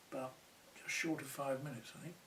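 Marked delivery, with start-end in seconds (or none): none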